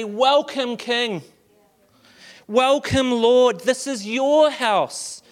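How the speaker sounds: high declaring worship prayer; impassioned worship-leader prayer; fervent prayer. Acted. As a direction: proclaim it to the room. Leads congregation in prayer.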